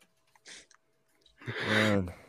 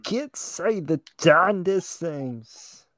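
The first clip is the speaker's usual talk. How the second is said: Cosby impression; 6 semitones higher, much slower than usual. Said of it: "you got an impression?".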